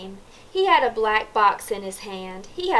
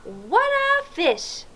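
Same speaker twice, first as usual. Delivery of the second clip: put-on slow, higher "What a fish" for children to copy; amazed exclaim demo; 11 semitones higher, slower than usual. Shown as a demonstration. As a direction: draw it out in wonder. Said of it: "Can you say that?".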